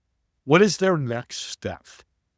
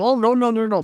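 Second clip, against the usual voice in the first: mild reassurance; 10 semitones higher, 5 dB louder than usual. Retold or imitated. Grateful mother reassures Steve.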